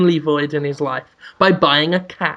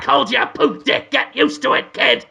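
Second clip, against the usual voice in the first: high, brash cartoon cat; bossy talking cat putting down its owner; Willem Dafoe; 6 semitones higher than usual. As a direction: insult him in a pushy, high character voice.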